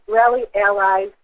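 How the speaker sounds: ordinary talk, nothing marked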